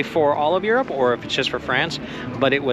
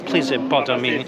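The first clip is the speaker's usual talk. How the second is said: a hint of a mock French accent; lightly voiced French accent apology; slipping into a French accent. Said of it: "I did it again".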